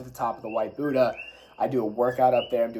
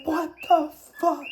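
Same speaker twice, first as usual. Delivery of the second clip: high, slow, drawn-out shocked exclamation; stunned disbelief; 3 dB louder than usual. Retold or imitated. Peleg still stunned on his bike: high, slow disbelief. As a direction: stretch it out, unable to believe it.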